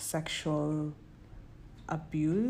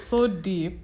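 laughing; 2 semitones higher, 6 dB louder than usual. Real, not acted. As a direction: laugh then repeat it, giggling. Laughs at the joke.

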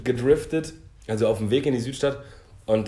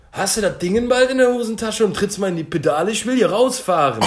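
higher, louder mock yell of an angry driver; retold shouting driver; 8 semitones higher, 6 dB louder than usual. Retelling a driver yelling from his car, mock yell. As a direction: bark it like a driver leaning out the window. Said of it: "schreit er mir so rüber".

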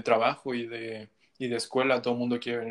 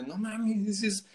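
surprised; 9 semitones higher, 5 dB louder than usual. Retold or imitated. Friend's shocked reaction to Instagram story.

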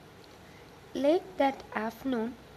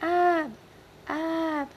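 loud, high, drawn-out call; 6 semitones higher, 7 dB louder than usual. A narrator's reading. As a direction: call for a missing son in the woods. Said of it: "ran into the woods calling".

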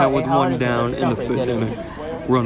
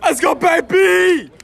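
cheering shout; 5 dB louder, slower than usual. Real, not acted. Cheering teammate from dugout.

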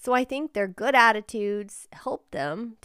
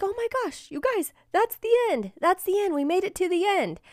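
much higher, thrilled disbelief; excited; 11 semitones higher than usual. Retold or imitated. Mom spotting the finish sign, thrilled and high. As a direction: squeal it like you just spotted the finish. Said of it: "And I was like".

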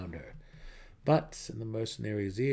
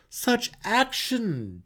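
much higher, louder, slippery rogue; a mercenary wriggling out of a request; Jarlaxle, evasive mercenary; 7 dB louder, much slower than usual. Acted. Jarlaxle making excuses to the matron, higher and louder.

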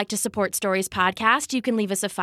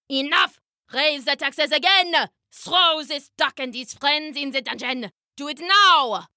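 higher-pitched, agitated royal commands; shouting king; 4 semitones higher than usual. Acted. Stung king shouting at the guards, higher agitated rant. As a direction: order everyone about as a ranting tyrant. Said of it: "started shouting at the guards".

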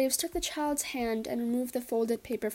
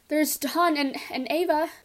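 restrained yell, higher and louder; 5 semitones higher, 5 dB louder than usual. A narrator's reading. Tessie names other family members to draw, yelling. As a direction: pipe up in raised-voice protest. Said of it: "Missus Hutchinson yelled".

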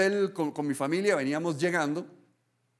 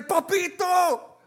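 much higher, loud, slow and stretched shout of delight; a child flinging herself at her returning dad; joyful child's shout; 9 semitones higher, 4 dB louder, slower than usual. Pretend. Child greeting father home, shouting with joy. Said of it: "correr a sus brazos y decirle".

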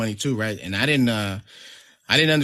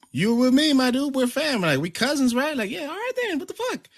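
high-pitched, insistent coaxing; retold urging. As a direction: imitate talking a reluctant cousin round, pitched up. Retold urging of hesitant cousin.

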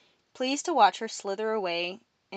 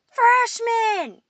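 very high, loud summons; shouted roll call; 9 dB louder than usual. A narrator's reading. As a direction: yell the class name to the room. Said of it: "A boy from two tables over leapt up".

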